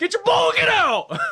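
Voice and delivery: high, loud shout; angry shout. Pretend. Yelling angry staff.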